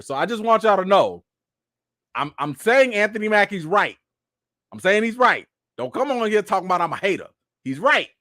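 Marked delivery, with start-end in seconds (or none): none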